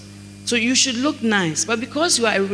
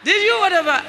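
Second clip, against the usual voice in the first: higher, prying questions at the husband; higher mock nagging wife; 8 semitones higher than usual. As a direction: pester him for answers.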